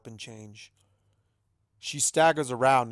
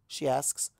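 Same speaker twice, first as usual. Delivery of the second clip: ordinary delivery, nothing marked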